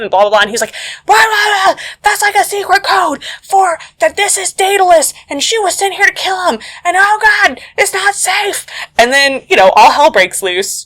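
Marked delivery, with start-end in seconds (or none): 1.0-8.6 much higher hammed-up alarm; mock panic. Agent warning Mitch that Sam was sent to kill, mock panic. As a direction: squeal it like a flustered agent realising the danger.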